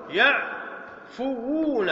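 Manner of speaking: يعفوون stretched very slowly, both waws held; drawn out. Shown as a demonstration. Teacher to students, very slow يعفوون. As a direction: linger on the double waw in يعفوون. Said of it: "مباشرة نضيف الواو والنون".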